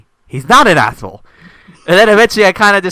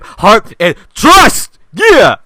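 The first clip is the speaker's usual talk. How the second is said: rising louder and higher to a cheer; hammed-up rally; 8 semitones higher, 7 dB louder, much slower than usual. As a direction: build it like an anime hero. Hamming up a Pokemon friendship speech.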